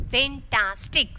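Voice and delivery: slow "Fantastic" in three separate beats; split into syllables. Shown as a demonstration. Teacher showing the syllable separating, "Fantastic" split slowly. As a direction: break "Fantastic" into fan, tas, tic. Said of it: "syllable is separating".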